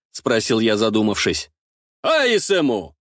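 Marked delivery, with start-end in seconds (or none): 2.0-3.0 higher-pitched defiant retort. A narrator's reading. Ostap answers his rival's dare about chain mail, defiant. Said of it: "с вызовом сказал Остап".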